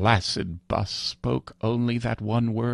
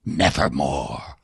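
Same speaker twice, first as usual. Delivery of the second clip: much lower, slow raven voice; raven's reply; 8 semitones lower than usual. Acted. Raven answers the pleading narrator, low and slow. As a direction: drop it low and final. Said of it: "Quoth the Raven".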